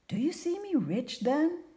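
high-pitched eager question; excited exclamation. A narrator's reading. Carpenter asks fortune teller about riches, excited exclamation. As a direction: ask it with rising, hopeful excitement. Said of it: "exclaimed the carpenter".

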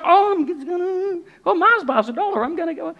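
edge away from the pushy friend; trying to get out of a prayer in the shop line; awkward escape. Retold or imitated.

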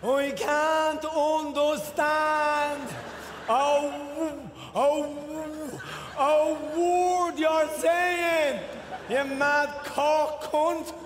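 very slow, drawn-out exasperation; hugely elongated, fed-up delivery; exasperated slow reply. Acted. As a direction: stretch every word out long to the fast talker. Exasperated local answers, drawing words out.

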